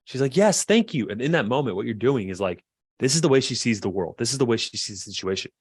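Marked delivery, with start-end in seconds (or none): 0.4-1.1 high, loud grateful outburst; emphatic, pitched-up relief; relieved wife. Retold or imitated. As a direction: exclaim it as if finally understood. Acted wife, relieved at being understood.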